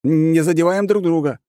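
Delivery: high, loud urgent battle shout; shouted correction. Acted. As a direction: yell the warning to the team. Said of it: "тут же поправляясь".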